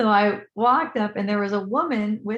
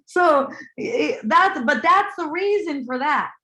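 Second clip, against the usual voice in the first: high, loud, tickled voice; amused outburst; 8 semitones higher, 5 dB louder than usual. Real, not acted. Trying to talk with that face, laughing. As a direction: talk on while cracking up.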